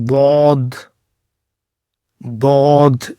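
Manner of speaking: ضاد heavy, the left tongue edge to the side teeth; Arabic dad demo. Shown as a demonstration. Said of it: "زبان کا جو left کنارہ".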